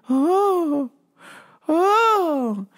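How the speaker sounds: high, drawn-out oh; confused customer. Retold or imitated. Customer told Mike is off today, high drawn-out confused oh. Customer's disappointed dawning response.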